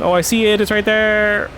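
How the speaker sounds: excited. Real, not acted. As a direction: call it out excitedly. Excited at spotting the target.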